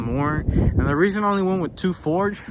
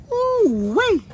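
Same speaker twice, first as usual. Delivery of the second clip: high ooh-wee; 4 dB louder than usual. Real, not acted. Reacting to a rusty part.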